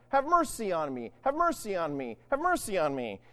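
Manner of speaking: usual speaking voice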